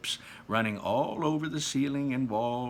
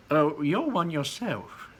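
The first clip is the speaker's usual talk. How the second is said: Wonka voice; 5 semitones higher, 5 dB louder, slower than usual. Acted. Wonka voiced in read-aloud.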